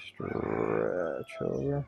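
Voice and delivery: very slow, low, elongated stretch; long, low, effortful-sounding cue; drawn-out cue. Real, not acted. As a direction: drag the first word right out as the body reaches.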